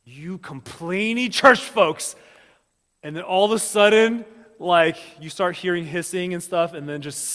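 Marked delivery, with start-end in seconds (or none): 0.0-2.1 loud, raised mock-divine rebuke; hammed-up exasperated God; God fed up. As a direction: call down loudly on the complainers, drawing it out. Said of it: "God's like".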